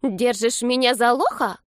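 indignant granny. A narrator's reading. Grandma challenges grandson in teen slang, high slow indignation. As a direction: grandmother drawing out an offended question. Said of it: "бабушка Рафаэля знает современный подростковый жаргон".

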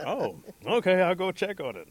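loud, high "oh, okay"; roused agreement. Acted. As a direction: agree loudly, jolted by the noise. Bear gives in after noise.

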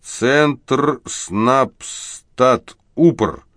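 very slow, syllable by syllable; the chekist struggles through the abbreviation; halting slow reading. A narrator's reading. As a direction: spell out "Центр Снабстат Упр" with difficulty. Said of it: "медленно, с явным затруднением прочёл".

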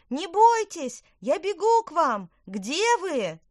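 high, very loud reassuring shout; urgent calling out. A narrator's reading. Witch running to hidden twins, urgent shout. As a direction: call across the garden.

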